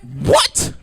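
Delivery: high squeaky what; shocked. Real, not acted. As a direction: yelp it in disbelief. Shocked reaction to therapist.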